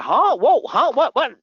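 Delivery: high, loud baffled what; stunned disbelief. Retold or imitated. Agent shocked as the customer bails, high loud disbelief. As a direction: thrown by the sudden refusal, voice leaps up.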